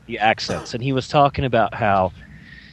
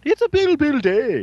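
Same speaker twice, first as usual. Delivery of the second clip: much higher, silly cartoon puppet voice; platypus puppet voice; 12 semitones higher than usual. Joking about Brits, imitating the Mister Rogers platypus puppet. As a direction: squeak it out like a children's TV puppet. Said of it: "the platypus on Mister Rogers".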